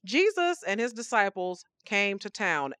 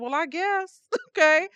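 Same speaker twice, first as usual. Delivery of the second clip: higher, laughing it off, louder at the end; resigned laugh; 8 semitones higher, 4 dB louder than usual. Retold or imitated. Told Parisians are on vacation, laughing resignedly. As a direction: shrug and laugh it off. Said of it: "I said".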